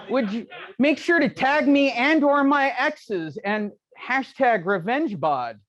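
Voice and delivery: slow, laughing tease. Real, not acted. Coach teasing the photographer, slow and laughing. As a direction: drawl the joke out through a laugh.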